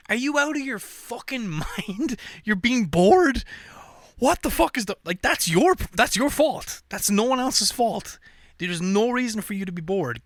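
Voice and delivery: you can't believe anyone could be bored; incredulous rant. Real, not acted. Ranting at bored people.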